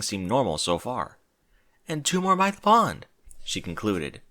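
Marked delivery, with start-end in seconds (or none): 1.9-3.1 timid Fluttershy voice. Acted. Fluttershy counting.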